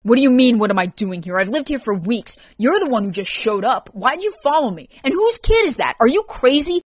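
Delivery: heated, rising outburst of questions; agitated, increasingly high-pitched quarrel; indignant. Acted. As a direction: argue back in outraged disbelief. Quarrel over intrusion and child.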